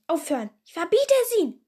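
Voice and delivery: high, loud frantic order; shrieked, panicky ban; panicked shout. A narrator's reading. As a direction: cry out desperately to stop it. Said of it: "schrie Onkel Vernon in Panik".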